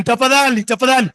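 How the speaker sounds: ordinary delivery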